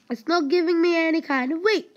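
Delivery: high, loud, fast protest; frustrated complaint. Real, not acted. Player denied wheat by the game, loud frustrated complaint. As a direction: complain loudly in a rush.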